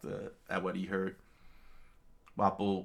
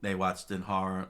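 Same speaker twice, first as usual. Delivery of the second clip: ordinary delivery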